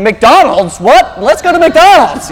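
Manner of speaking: put-on light up like a kid spotting food; excited kid. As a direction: Tucker in the back seat begging for McDonald's, excited. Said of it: "Tucker's in the back going".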